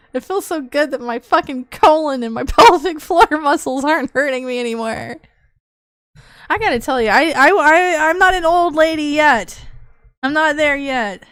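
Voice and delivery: ordinary delivery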